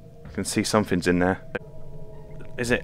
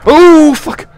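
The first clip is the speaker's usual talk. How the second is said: real startled scream, much higher and louder; frightened scream; 16 dB louder than usual. Real, not acted. Player startled by a horror game jump scare, screaming. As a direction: yell it as the scare hits.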